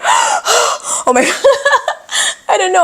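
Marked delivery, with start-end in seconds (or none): 0.0-1.2 much higher, breathless "oh my"; panicked gasp. Retold or imitated. Reliving being trapped inside, panicked high exclamation. 1.3-2.5 a nervous high giggle; high nervous laugh. Real, not acted. Vlogger laughing at her own panic, high giggle.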